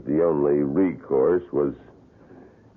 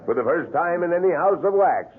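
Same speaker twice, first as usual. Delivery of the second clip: showman tour guide; 13 semitones higher than usual. Acted. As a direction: sell each horror to the crowd like a showman. Tour guide presents wax murders.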